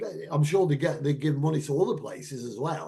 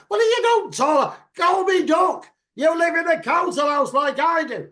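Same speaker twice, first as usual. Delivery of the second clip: high, loud, hammed-up telling-off; mock indignant shout; 13 semitones higher, 8 dB louder than usual. Scolding Tara for acting posh, mock indignant shout. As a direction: yell it at someone putting on airs.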